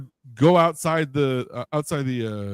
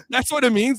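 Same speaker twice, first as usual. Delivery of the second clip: amused disbelief; 11 semitones higher than usual. Retold or imitated. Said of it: "I'm like".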